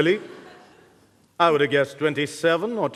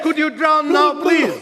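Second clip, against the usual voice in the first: impatient shout; 9 semitones higher, 4 dB louder than usual. Acted. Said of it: "I said".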